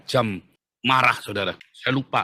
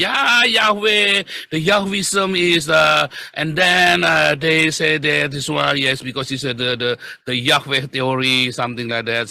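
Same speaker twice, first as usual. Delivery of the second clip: slow, drawn-out English lecturing; relaxed lecturer imitation; slower than usual. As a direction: imitate an easygoing professor rambling in English. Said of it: "dia ngomong santai sekali".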